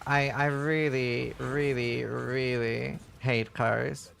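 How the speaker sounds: really dragged out slowly; drawn-out, emphatic really; stress on REALLY. Real, not acted.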